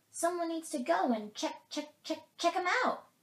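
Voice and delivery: high, loud squirrel chatter. A narrator's reading. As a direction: chatter the repeats like an excited squirrel. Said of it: "chattered the squirrel".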